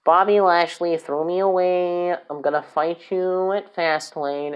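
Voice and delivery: lightly put-on wrestler voice, slow at the end; a hint of a scorned wrestler vowing revenge; mock Lio Rush voice. Fan lightly voicing Lio Rush's revenge promo, slow and put-on. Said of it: "He doesn't sound like this".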